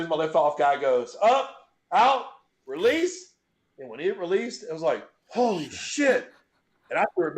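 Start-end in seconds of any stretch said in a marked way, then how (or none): 1.2-3.3 raised, punchy spotter's count; slow, higher-pitched call; handoff call. Retold or imitated. Acts out handoff man's call. 5.3-6.3 retold shock.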